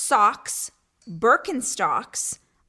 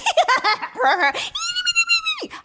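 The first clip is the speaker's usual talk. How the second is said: very high, squealing giggle; hammed-up nervous giggling squeal; mock giddy cackle; 5 dB louder than usual. Shown as a demonstration. Showing how she didn't react to her date, mock giddy squeal. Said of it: "I didn't go like".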